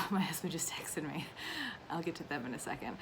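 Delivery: quick breathy aside under her breath; whispered aside. Real, not acted. To viewers about a text, a quick whispered aside. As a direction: whisper it off to the side. Aside while filming live video.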